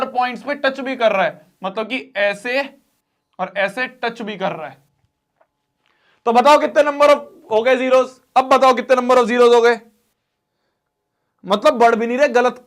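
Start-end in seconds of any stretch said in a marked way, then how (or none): 6.3-9.8 loud, pressing question; demanding. Real, not acted. Teacher loudly pressing students for the number of zeros. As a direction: push the class for the answer.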